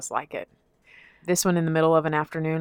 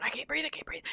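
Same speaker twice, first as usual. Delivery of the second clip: high, strained whispered panic; panicked breathless whisper; 8 semitones higher, 14 dB quieter, faster than usual. Retold or imitated. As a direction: gasp it out without breath.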